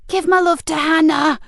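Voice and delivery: high, loud parting taunt; villain shouting a taunt. A narrator's reading. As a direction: fling it back as she flees. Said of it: "shouted Frankie".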